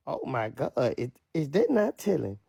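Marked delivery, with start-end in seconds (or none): none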